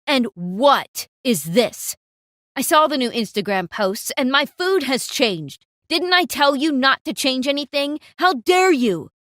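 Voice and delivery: loud, furious tirade. Acted. Returning manager scolds the cook, furious and loud. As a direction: tear into her for changing the food. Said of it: "He was furious".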